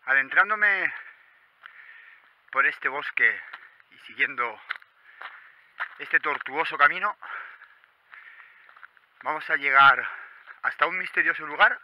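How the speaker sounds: loud, high, bright presenter voice; vlog intro announcer. Acted. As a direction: project it like a show opener. Opening intro of anniversary episode.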